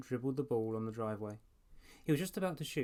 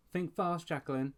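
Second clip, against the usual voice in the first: higher, louder taunting call; teasing shout; 6 semitones higher, 4 dB louder than usual. A narrator's reading. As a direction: shout it out as you hurl the ball. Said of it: "he shouted".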